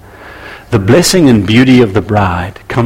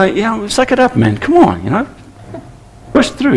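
higher, mocking tough-love voice; mock the people telling you to toughen up; dismissive brush-off; 11 semitones higher than usual. People brushing off a hurt leader, mocking tough-love tone.

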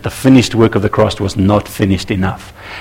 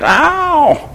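sharp high cry of pain; mock pained ow. Leader worn down by paper cuts, high mock pained cry. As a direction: yelp as if every cut stings at once.

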